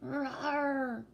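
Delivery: cute mock dragon growl; playful dragon rawr. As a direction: roar like a little dragon. Dragon roar for viewer.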